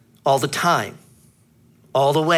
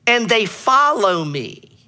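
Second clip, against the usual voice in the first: preaching; 6 semitones higher, 4 dB louder than usual. Acted. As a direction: proclaim the verse to the congregation. Opening scripture, preached emphatically.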